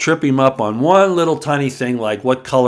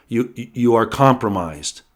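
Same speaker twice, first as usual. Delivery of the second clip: usual speaking voice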